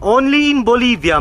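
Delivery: loud, high burst of wonder; excited exclamation. Real, not acted. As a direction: call it out in awe. Exclaiming at the view.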